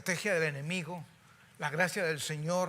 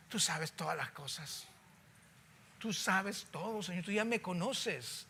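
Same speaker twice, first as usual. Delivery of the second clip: soft, lowered-head confession; ashamed reply; 6 dB quieter than usual. Acted. Peter answers Jesus softly, ashamed. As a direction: say it softly, head bowed in shame. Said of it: "agachó apenado la cabeza".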